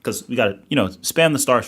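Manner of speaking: loud, hyped-up streamer delivery; rally the chat behind a big idea; excited pitch. Real, not acted. Hypes a new stream idea to chat.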